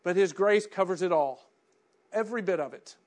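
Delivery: loud preacher's build; impassioned preaching. Acted. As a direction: preach it at full voice. Preaching at full force.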